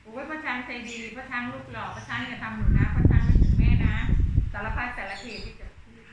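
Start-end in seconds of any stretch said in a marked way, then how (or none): 0.0-3.9 put-on loud begging child. Boy begs Khun Chang loudly.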